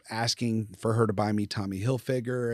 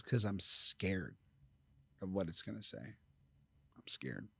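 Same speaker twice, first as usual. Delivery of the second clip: retold fearful admission; 3 semitones lower, 9 dB quieter than usual. Mother confessing to her son why the letter is unread, hushed and scared. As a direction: let it sink almost to a whisper.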